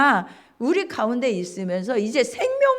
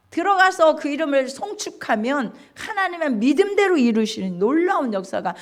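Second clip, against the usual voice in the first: normal talking voice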